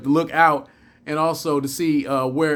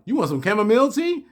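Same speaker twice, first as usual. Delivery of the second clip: quick, much higher mock disbelief; incredulous; 7 semitones higher, faster than usual. Viewer mocks Worf's tea offer, quick incredulous pitch-up. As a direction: toss the question off fast, mock-amazed.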